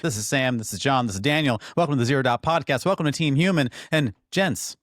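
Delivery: high, loud host welcome; podcast intro. Real, not acted. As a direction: call out the show opening with punch.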